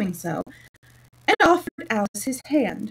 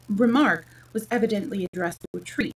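ordinary delivery

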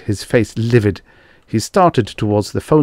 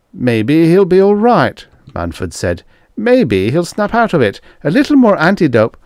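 high-pitched, louder, wishful voice; anxiously hopeful; 10 semitones higher, 6 dB louder than usual. A narrator's reading. Manford hoping aloud the patient recovers, anxiously. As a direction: grasp at any hope.

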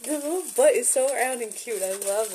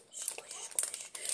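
soft whispered squish; playful whisper; 17 dB quieter than usual. Real, not acted. Whispering while squeezing candy.

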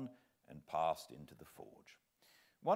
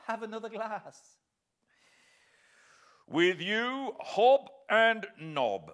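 much higher, louder slow toast; jovial toast; 12 dB louder than usual. Acted. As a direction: raise a glass heartily. Toasting over wine.

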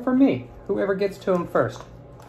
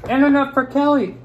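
playful; 8 semitones higher, 5 dB louder than usual. Acted. Playful nut handout, louder.

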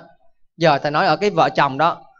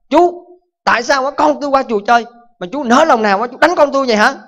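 angry father; 5 semitones higher, 4 dB louder than usual. Acted. Angry father accuses the monk.

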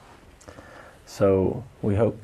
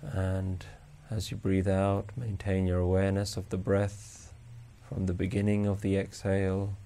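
slow, soft, even meditation voice; guided meditation; 10 dB quieter than usual. Acted. Meditation teacher guiding listeners to notice each breath, calm and unhurried. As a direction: guide them gently through the breath with long pauses.

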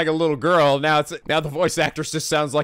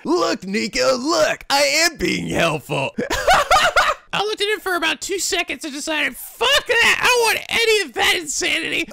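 put-on high girly voice; 12 semitones higher than usual. Character voice banter.

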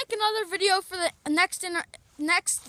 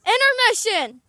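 loud, ringing break announcement; announcer's call; 5 semitones higher, 11 dB louder than usual. Pretend. Announcer stops play for the break, loud call. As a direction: call it out to the whole field.

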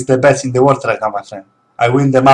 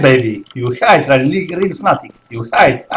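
usual speaking voice

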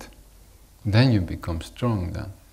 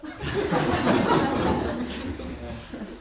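a high airy laugh; real giggle, much higher; high breathy giggle; 13 semitones higher, 4 dB quieter than usual. Real, not acted. Laughing at quacking.

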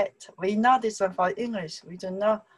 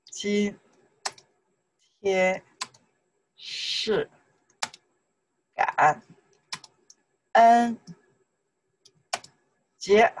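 isolated syllables with long pauses; much slower than usual. Shown as a demonstration. Teacher drilling a sentence, one syllable at a time. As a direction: give each syllable on its own.

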